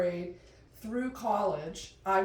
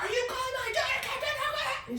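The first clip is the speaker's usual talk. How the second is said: put-on high, laughing dad confronting a kid; mock angry father. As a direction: play the furious dad through a laugh.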